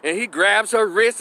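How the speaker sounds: plain reading voice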